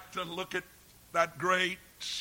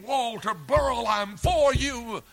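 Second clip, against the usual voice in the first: God's loving command; 8 semitones higher, 7 dB louder than usual. Acted. God calling Walter Burrell away from sin, pleading shout. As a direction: call him by name and warn him off sin. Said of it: "God's command is saying".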